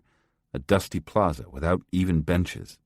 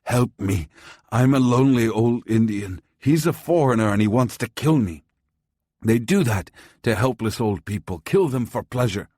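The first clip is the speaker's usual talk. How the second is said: put-on high, loud pleading old man; frantic old man; 4 semitones higher, 4 dB louder than usual. A narrator's reading. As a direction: beg strangers for help, panicking. Old man begging crowd for help.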